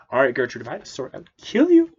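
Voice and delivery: mock angry threat. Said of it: "He's like".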